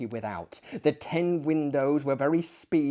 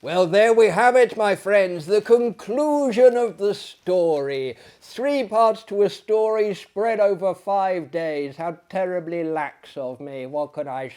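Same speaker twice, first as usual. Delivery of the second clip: louder, brighter host voice addressing friends; cheery sign-off; 5 semitones higher, 7 dB louder than usual. Real, not acted. Host addresses his friends after the story, cheery sign-off. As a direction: wrap up the story for the audience warmly. Narrator signs off to listeners.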